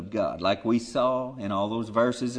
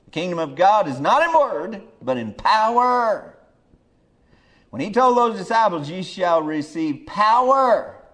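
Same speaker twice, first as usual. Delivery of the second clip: preaching at full force; 5 semitones higher, 6 dB louder than usual. Real, not acted. Preaching on power.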